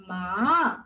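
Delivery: "ma" starting low and sliding up; second-tone "ma", pitch climbing to the end; rising second tone. Shown as a demonstration. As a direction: take "ma" upward from a low start. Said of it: "The second tone is a rising tone".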